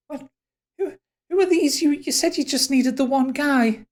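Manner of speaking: high, startled questioning; bewildered. Acted. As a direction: stammer it in high-pitched confusion. Elf startled by extra strangers.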